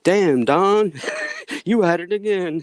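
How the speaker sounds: loud, high, thrilled reaction; excited laughing praise. Real, not acted.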